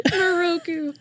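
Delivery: high, laughing name call; mock anime call. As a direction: echo a playful parody of an anime cry.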